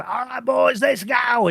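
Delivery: a quick heroic rallying cry, lightly voiced; Brendan Gleeson rallying. Retelling the movie rescue, a light rallying shout. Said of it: "then you hear Brendan Gleeson".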